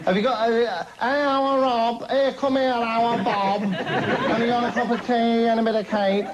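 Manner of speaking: high, put-on voice for the tipsy visiting guest; Stella impression. Stella dropping in, asking for tea and cake, high put-on voice. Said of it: "and she says".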